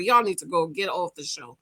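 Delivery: ordinary delivery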